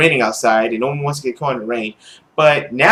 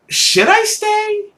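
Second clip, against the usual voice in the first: much higher, slow, drawn-out teasing voice; mock question; much slower than usual. Real, not acted. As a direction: stretch it out in a silly high squeak. Mocking the game's question.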